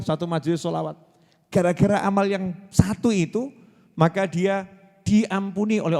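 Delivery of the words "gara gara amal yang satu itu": ordinary delivery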